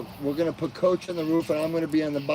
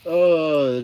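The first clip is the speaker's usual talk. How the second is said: yawn; 3 semitones higher, 8 dB louder than usual. Real, not acted. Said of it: "Oh, I'm yawning again".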